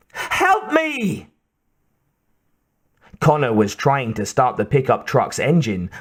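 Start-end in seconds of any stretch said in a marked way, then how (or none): 0.1-1.3 very high-pitched desperate cry; scream for help. A narrator's reading. Trapped Kate screams for help.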